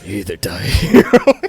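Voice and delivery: deep, loud movie-warrior voice; Gerard Butler. Acted. Friend retrying the tired hero line, deep Butler-like voice. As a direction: push it low and forceful. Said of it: "you sound like Gerard Butler".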